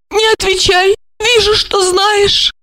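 high female voice; bashful lament. A narrator's reading. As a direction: cut him off in distress. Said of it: "Стыдливо потупилась Ольга".

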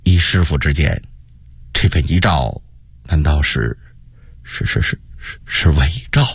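low, halting question with long pauses; hesitant stammer. A narrator's reading. Zhu Di nervously asks his master about the edict, stammering. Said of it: "他才结结巴巴地问道".

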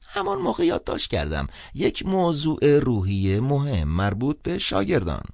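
put-on startled old priest on the phone. A narrator's reading. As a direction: answer like a wary old cleric. Old priest reads note.

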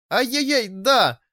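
high playful ouch; pained yelp. A narrator's reading. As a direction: squeal as if pinched. Pinched, cries out.

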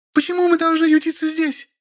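very high whining wife; indignant squeal. A narrator's reading. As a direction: squeal the complaint in falsetto. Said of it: "взвизгнула Машенька". (возмущённый визг)

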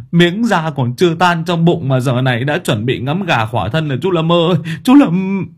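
high, loud mock-mourning wail; keen over a friend as if he were dead, swelling on his name; wailing lament. A narrator's reading. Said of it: "một thằng bạn nghiện vừa chu chéo".

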